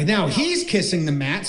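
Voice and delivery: ordinary talk, nothing marked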